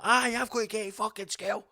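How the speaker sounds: put-on high-pitched child with a Scottish accent; put-on wee kid voice; mock wee kid. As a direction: pipe up like an indignant schoolboy. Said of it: "this wee kid just appears".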